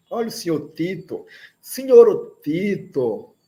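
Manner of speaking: ordinary delivery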